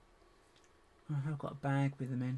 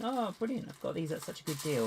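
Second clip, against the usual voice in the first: higher, hammed-up pleased exclamation; mock delight; 5 semitones higher than usual. Imagining a buyer pleased with a deal, mock delighted. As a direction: play the thrilled bargain hunter.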